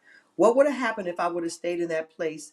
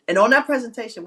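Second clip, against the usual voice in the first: ordinary talk, nothing marked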